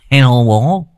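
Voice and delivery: a hint of a cheeky young son. A narrator's reading. Son greeting his crashed father cheekily. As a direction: tease your father playfully.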